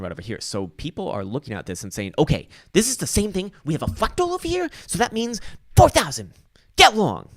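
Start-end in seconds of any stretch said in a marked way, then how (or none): 2.2-7.3 high, loud mock voice of an overexcited crypto bull; mocking hyped trader. Mocking people who see a fractal and go long, in a high, loud voice. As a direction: squeal it like a naive bull who is sure of the pump.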